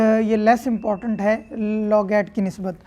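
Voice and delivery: ordinary delivery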